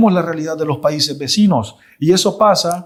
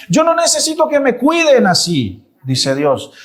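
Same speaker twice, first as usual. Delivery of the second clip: emphatic God; 6 semitones higher than usual. Acted. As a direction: proclaim it as God refusing care. Preacher voicing God's defiant reply.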